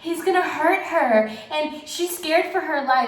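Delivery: high, mocking dramatic gasp; mocking drama channels. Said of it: "these drama channels are like".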